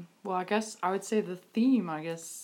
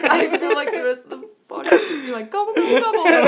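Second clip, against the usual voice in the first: louder, higher, laughing throughout; loud laughing chicken joke; 11 semitones higher, 13 dB louder than usual. Real, not acted. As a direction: crack up while mocking the gobble. Guest laughing mimics chicken.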